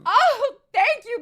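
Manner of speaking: high, slow, flattered; mock flattered. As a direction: coo it back high and slow. Flattered gasp, high slow reply.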